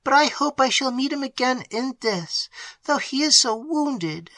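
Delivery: high, tearful maid slowing at the end; cling to hope through tears; sobbing. A narrator's reading. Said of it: "sobbed Annette".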